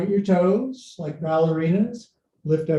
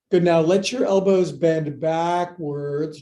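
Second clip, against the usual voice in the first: ordinary delivery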